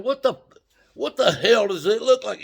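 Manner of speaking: high, loud and indignant; exasperated retort. Retold or imitated. To the cop asking what he's doing, loud exasperated retort. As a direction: throw it back at him, fed up.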